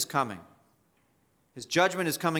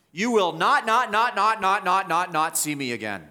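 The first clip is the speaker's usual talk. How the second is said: each "not" punched higher and louder, fading on the last; hammered repeated not; 4 semitones higher, 4 dB louder than usual. Shown as a demonstration. Preacher showing the congregation the Greek double negative, hammering each "not". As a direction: pound out every "not" like a drumbeat. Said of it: "The double negative comes out".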